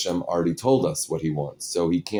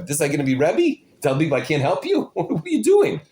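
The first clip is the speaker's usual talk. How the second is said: imitated outrage, high-pitched incredulous questions; indignant scolding; 8 semitones higher, 4 dB louder, faster than usual. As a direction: mimic a brother's rebuke, climbing higher and louder. Brother scolds the new rebbe.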